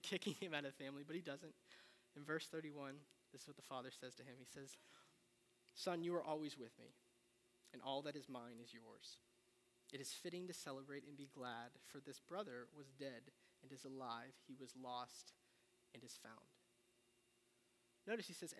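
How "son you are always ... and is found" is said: quiet, fading Bible reading; reading the father's reply gently, growing softer; scripture reading. Acted. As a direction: let the father's words settle softly.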